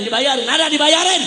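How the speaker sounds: high, forceful pulpit call; insistent shout. Real, not acted. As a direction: push it out loud to the crowd. Pressing the crowd.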